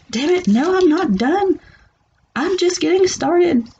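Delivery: high, pushy mock protest; voiced high and pushy, not backing down; mock defiant outburst. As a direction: play the stubborn one insisting. Voicing the defiant reader.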